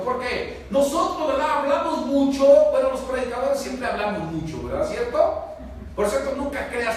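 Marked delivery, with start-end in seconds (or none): none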